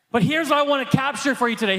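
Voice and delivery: ordinary delivery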